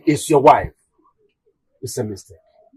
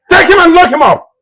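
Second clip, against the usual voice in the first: high, loud royal order; furious command; 10 semitones higher, 7 dB louder, faster than usual. Acted. King ordering guards to seize his son, furious shout.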